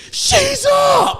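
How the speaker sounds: shouted high and loud, teasing; mocking shout. Teammates calling out to the thrower, loud shout. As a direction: yell it out like a crowd calling her up. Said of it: "they go".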